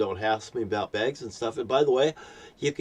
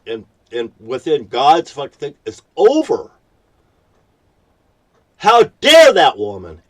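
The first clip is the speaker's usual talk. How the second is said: real anger, much higher and louder; furious rant; 5 semitones higher, 9 dB louder than usual. Real, not acted. Ranting to viewers about the Safeway clerk, furious and loud. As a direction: rail at the store that wronged him.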